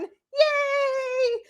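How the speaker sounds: high squeal of joy; excited yay. Real, not acted. Cheering for a gifted membership.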